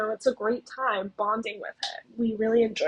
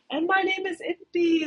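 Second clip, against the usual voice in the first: imitating a grand hollered introduction; mock loudspeaker scream; 7 semitones higher, 3 dB louder than usual. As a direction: proclaim your name high over a loudspeaker. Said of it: "screaming on the loudspeaker".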